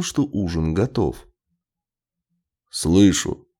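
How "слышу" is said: curt, grumpy acknowledgement; short irritated grunt; grunted reply. A narrator's reading. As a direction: grunt it out, annoyed at being bothered. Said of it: "буркнул Лестер".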